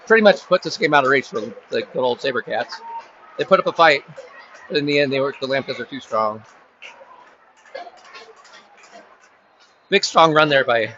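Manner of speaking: live sports call, running commentary pace; play-by-play. Acted. Announcer calling the game for listeners, live play-by-play. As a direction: narrate each play as it unfolds.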